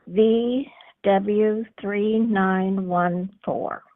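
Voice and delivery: slow, careful read-back; dictating. Real, not acted. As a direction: spell each item out slowly. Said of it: "You wanna read that back to me?".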